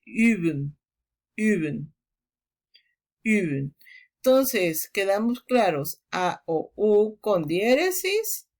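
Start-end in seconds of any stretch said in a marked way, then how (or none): 0.0-3.8 üben, lips in a u shape saying i, lower; German umlaut. Shown as a demonstration.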